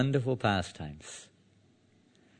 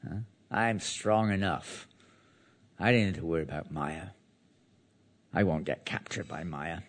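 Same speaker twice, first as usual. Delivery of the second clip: mock overconfidence; 4 semitones lower, 4 dB quieter than usual. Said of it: "we sometimes think like that".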